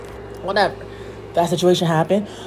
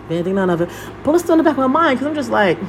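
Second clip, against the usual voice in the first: ordinary talk, nothing marked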